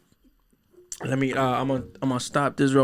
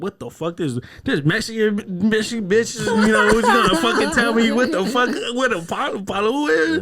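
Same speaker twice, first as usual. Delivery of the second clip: mocking staff voice; 9 semitones higher, 4 dB louder than usual. Mocking kava lounge staff.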